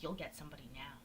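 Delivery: low, quiet retold demand; controlled anger. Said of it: "And I fucking lost it".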